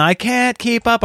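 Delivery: high, slow imitation of a child's whine; whining kids. Kids falling behind dad in the airport, mock whining. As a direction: drag it out like a tired kid.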